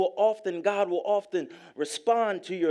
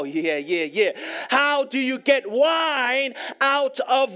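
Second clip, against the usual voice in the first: louder, rising preacher's cadence, pressing each line home; full-force preaching; 6 semitones higher, 6 dB louder than usual. Acted. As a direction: preach it to the congregation with building fervour.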